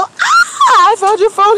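high, loud, delighted Ah; excited cry. Retold or imitated. Handing back the lost phone, gleeful and loud. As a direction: announce the find with glee. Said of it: "And I go".

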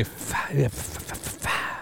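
fading into a whispered f-; ASMR whisper. Pretend. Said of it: "it's an A S M R podcast".

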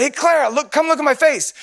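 high-pitched playful call; mock yell. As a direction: holler for the little niece. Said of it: "I kinda yelled".

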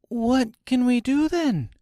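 put-on high-pitched little girl asking; Dorothy's voice. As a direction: ask helplessly in a high girl's voice. Girl Dorothy asks for help.

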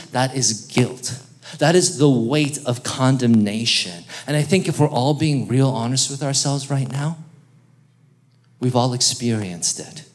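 ordinary talk, nothing marked

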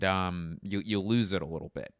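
normal talking voice

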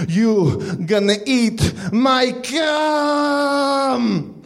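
drawn out, high; slow menace. Acted. Leonard threatens Johnny, slow drawn-out menace. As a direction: stretch every word slowly.

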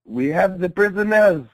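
mock French accent. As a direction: put on a hammed-up French accent. Acts French officer reporting to general.

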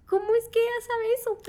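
high, loud imitation of crying disbelief; mock tearful wail. Woman retelling her tears at the pastor's words, mock tearful wail. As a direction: wail it in a high voice, half laughing at yourself. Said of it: "empecé a llorar y yo decía".

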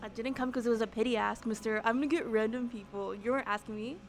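usual speaking voice

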